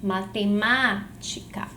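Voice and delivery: "matemática" slowly, with MÁ held long; stressed syllable shown. Shown as a demonstration. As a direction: lean on the "má" in "matemática". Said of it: "Qual é a sílaba tônica de matemática?".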